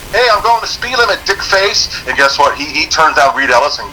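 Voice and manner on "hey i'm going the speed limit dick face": high mocking taunt; high taunt to the trooper. Retold taunt to cop.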